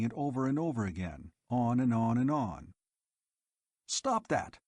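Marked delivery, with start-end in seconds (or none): 3.9-4.6 high, sharp snap; snapped. A narrator's reading. Dodeth silencing the looping robot, sharp high snap. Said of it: "snapped Dodeth".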